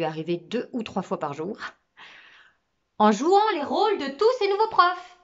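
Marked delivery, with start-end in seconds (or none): 3.0-5.2 high, loud excited exclamation; exclaiming. Acted. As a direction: burst out with the big idea. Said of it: "s'exclama-t-il".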